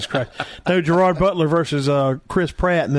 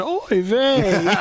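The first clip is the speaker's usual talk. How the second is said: laughing mock Yiddish oy vey; imitating a crowd groaning oy vey; yiddish accent; 7 semitones higher than usual. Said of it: "you'd hear eighty thousand people going".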